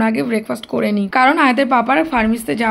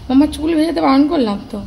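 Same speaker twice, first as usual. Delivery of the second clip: calling out; 4 semitones higher than usual. Real, not acted. Mother reminding daughter not to wet hair, calling out. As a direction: call to your daughter in the bath.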